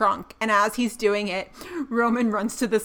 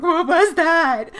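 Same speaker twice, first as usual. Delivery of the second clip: mock scared; 6 semitones higher, 6 dB louder, slower than usual. Roman scared, retold in corner.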